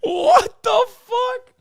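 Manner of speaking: high, slow, drawn-out cry; stunned disbelief. Real, not acted. Streamer crying out in shock at the joke, high and slow. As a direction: let it out in shock.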